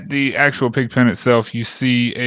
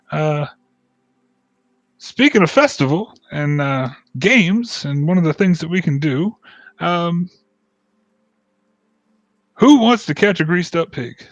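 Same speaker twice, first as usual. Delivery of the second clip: high, hearty festival host; jolly dwarf; 7 semitones higher, 4 dB louder than usual. Acted. Dwarf inviting the group to festival games, high and jolly. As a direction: sell the party with a big grin. GM voices dwarf pitching game.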